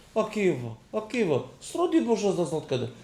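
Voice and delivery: high-pitched cries with falling ends; acted pleading. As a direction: cry out to Akiva in mock Ukrainian. Pleading cries to Akiva in story. (הויך רופן עקיבא)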